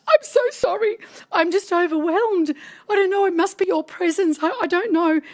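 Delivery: high-pitched, mock-weepy pleading; imitated tearful apology. Overwhelmed producer apologizing to His Holiness, mock tearful. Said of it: "and I kept crying".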